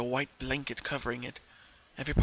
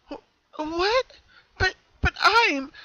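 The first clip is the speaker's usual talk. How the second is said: high, slow, broken-up stutter; falter, lost for words; stammering shock; 7 dB louder, slower than usual. Acted. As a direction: Pinkie stammering at her twin, shocked. Pinkie shocked by her double.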